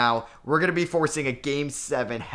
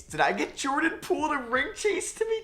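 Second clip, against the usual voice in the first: much higher, thrilled question; excited disbelief. Real, not acted. Gamer thinking a star signed with him, excited disbelief. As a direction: squeak in happy disbelief. Star free agent signing.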